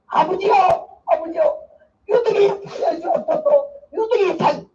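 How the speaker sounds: high, pleading Jeolla-dialect prayer, voice lifted; 전라도 사투리로 울부짖는 기도; crying Jeolla prayer. Retold or imitated. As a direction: cry out to God in Haenam dialect. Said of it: "전라도 해남 사투리를 울면서 기도를 하는데요".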